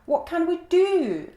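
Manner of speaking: a frightened gazelle pleading; worried gazelle. A narrator's reading. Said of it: "asked the gazelle".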